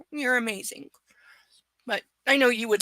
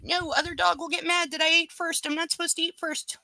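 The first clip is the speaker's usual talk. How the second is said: lightly raised, higher puppy voice; voicing the dog; 7 semitones higher than usual. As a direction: speak for the dog in a higher, childlike voice. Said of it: "He's like".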